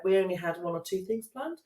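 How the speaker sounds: ordinary talk, nothing marked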